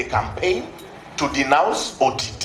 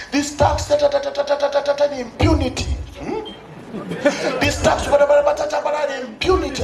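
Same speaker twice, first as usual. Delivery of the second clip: mock politician speech; 7 semitones higher than usual.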